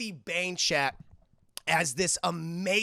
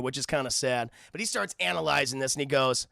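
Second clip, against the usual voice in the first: normal talking voice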